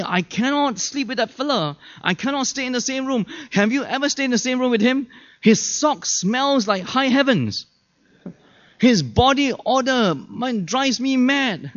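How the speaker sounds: high-pitched mock griping about a roommate; exasperated complaint. Camper moans to the committee about a smelly roommate, exasperated. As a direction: complain in a high, aggrieved voice, stretching the worst bits.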